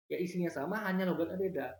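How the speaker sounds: normal talking voice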